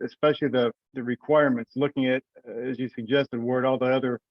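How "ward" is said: usual speaking voice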